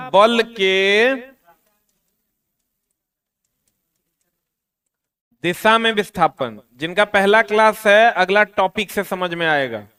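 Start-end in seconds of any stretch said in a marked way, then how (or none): 0.1-6.6 very slow, word by word for notes; slow dictation. Shown as a demonstration. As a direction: dictate so the class can write it down. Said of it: "teacher dictates heading for notes". (धीरे-धीरे लिखवाते हुए)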